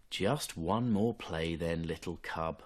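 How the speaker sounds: soft, slow giving-in mother; a hint of a tired mother yielding; lightly voiced mother fox. Mother Fox giving in to her cub, soft and slow.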